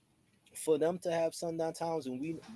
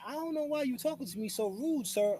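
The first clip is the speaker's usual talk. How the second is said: mock polite protest; 8 semitones higher than usual. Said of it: "he was like".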